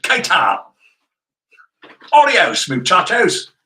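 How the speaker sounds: Spanish greeting in a loud, hammed-up Australian accent. Shown as a demonstration. Showing off a joke Australian accent to the co-host, loud and playful. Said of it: "In an Australian accent".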